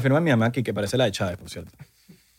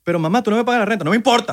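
laughing mock protest; 6 semitones higher, faster than usual. Son talking back to mom.

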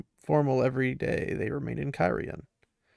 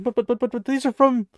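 higher, louder stammering protest; flustered innkeeper; 10 semitones higher, 6 dB louder than usual. Retold or imitated. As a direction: sputter like a panicked innkeeper. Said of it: "And Quayle's like".

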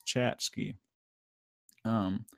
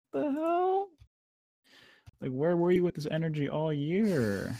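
much higher, baffled outburst; incredulous; 8 semitones higher than usual. Retold or imitated. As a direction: act stunned and offended. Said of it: "I'm like".

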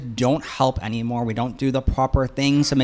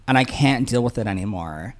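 ordinary talk

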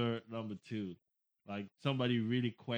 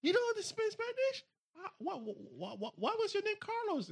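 mock baffled asker. Mocks a baffled Spanish speaker.

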